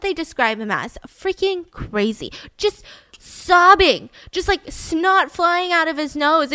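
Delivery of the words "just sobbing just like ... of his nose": higher, louder, slow retelling; excited retelling. Real, not acted. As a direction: stretch each word out in disbelief. Describing Jeremy sobbing.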